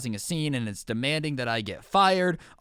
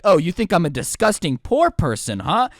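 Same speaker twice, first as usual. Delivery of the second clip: louder put-on indignation; hammed-up affronted accusation; mock offended; 2 semitones higher, 7 dB louder than usual. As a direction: imitate someone taking great offence. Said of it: "get offended and be like".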